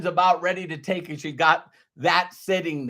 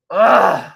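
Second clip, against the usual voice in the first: mock exasperated groan; 3 semitones higher, 10 dB louder than usual. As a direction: let out a fed-up groan. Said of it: "your mind goes".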